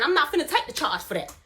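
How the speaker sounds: ordinary talk, nothing marked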